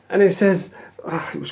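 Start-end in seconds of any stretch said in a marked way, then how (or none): none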